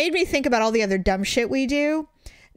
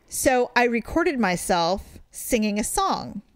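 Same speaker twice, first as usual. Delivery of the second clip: ordinary talk, nothing marked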